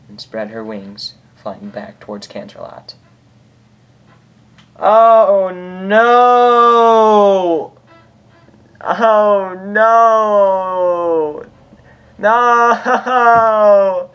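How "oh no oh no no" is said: high, loud real dismay; shocked outcry. Real, not acted. Reader reacting to the sad ending, loud shocked cry.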